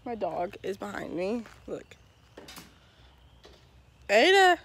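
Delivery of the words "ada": loud high call across the yard; calling the dog. Real, not acted. Owner calling her dog from the yard, loud.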